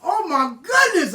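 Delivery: high, loud, slow "oh my goodness"; drawn-out thrilled gasp of words; delighted exclamation. Real, not acted. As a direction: savor it like a memory of a great cake. Remembering apple jelly cake.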